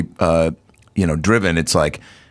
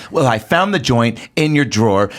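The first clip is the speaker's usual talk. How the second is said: higher-pitched acted mom accusing her daughter; confrontational mom; 6 semitones higher than usual. Mom confronting her daughter over a joint, mock accusing. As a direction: play a mother confronting her kid. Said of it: "the daughter of the mom when she was".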